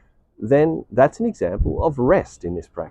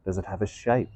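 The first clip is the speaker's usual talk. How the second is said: guided meditation; 4 dB quieter than usual. Real, not acted. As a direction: guide listeners inward, unhurried and hushed.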